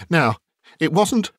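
mild exclamation, lifted high; eager cry. A narrator's reading. Bob telling the family of the nephew's kindness, mildly exclaimed. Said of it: "cried Bob".

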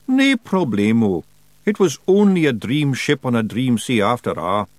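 a wee Scots pixie begging to keep a pet; Feagle voice. Acted. Feagle voice held.

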